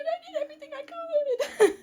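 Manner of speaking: hammed-up crying defence; tearful protest. Acted tearful defence after blame.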